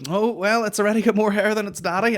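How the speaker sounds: imitated woman's joking remark; joking midwife. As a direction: chirp it up high like a teasing midwife. Midwife's joke acted out.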